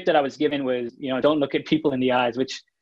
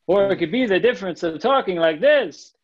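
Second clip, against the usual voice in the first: sing-song, higher and livelier pitch swings; sing-songy; 5 semitones higher, 3 dB louder than usual. Shown as a demonstration. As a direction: let the pitch bounce up and down like a song. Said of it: "super sing-songy".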